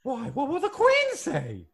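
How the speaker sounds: slow, high fretting matron; Missus Doubtfire. Acted. Fretting about the Queen, high quavering matron. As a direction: wail in a mock-British falsetto. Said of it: "put Missus Doubtfire away".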